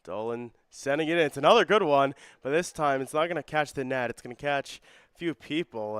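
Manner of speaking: excited soccer commentary, loud burst early; live play-by-play. Real, not acted. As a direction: jump to a loud call as the corner comes in, then ease off. Live call of a corner kick.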